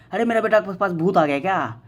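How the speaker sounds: lightly voiced mother, a touch alarmed; a hint of a worried mom calling out; startled mother. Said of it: "mummy बोले". (बच्चे की हँसी सुनकर हल्की घबराई मम्मी की आवाज़)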